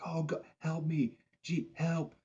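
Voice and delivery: high, drawn-out imitation of calling out; mock cry for help. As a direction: imitate a stranded woman calling across the water. Stranded woman calling for help.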